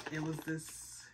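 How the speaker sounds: breathless hushed whisper; a stunned little whisper; awed whisper. Real, not acted. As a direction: whisper it like you can't believe it. Revealing the gift camera.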